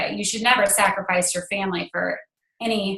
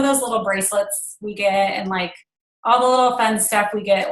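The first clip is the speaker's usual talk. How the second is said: usual speaking voice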